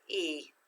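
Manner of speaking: E alone, long vowel, softly unaccented; syllable without accent. Shown as a demonstration. Teacher sounding out equip's first syllable, soft unaccented E. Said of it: "First syllable without the accent".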